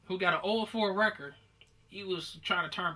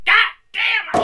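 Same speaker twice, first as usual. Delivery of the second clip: high, loud scream of pain; pained yell; 14 dB louder than usual. Retold or imitated. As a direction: yell it like your shoulder just popped.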